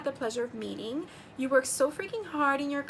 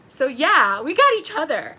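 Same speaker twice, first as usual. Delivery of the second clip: louder, beaming declaration; loud happy; 2 semitones higher, 11 dB louder than usual. Real, not acted. Excitedly announcing to viewers they drew each other. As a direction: proclaim it proudly. Repeating the line, now brightly.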